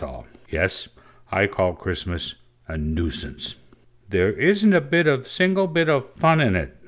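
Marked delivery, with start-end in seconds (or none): 4.1-6.8 put-on high-pitched girlish complaint; discontented. A narrator's reading. Ida grumbling to the others about Christmas, high and discontented. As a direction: voice a discontented child, pitched well up. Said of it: "said Ida discontentedly".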